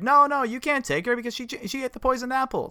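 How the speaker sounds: high, squeaky cartoon dwarf. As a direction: plead like a panicky little dwarf. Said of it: "the dwarves are like".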